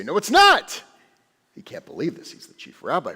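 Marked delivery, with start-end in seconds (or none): 0.0-0.8 students objecting. Acted. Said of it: "All the students say".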